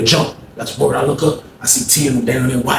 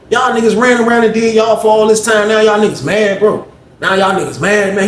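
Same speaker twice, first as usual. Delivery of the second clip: higher-pitched indignant argument; worked-up objection, pitched up; retold protest; 7 semitones higher than usual. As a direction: argue back, replaying the quarrel. Acted quarrel, protesting to T.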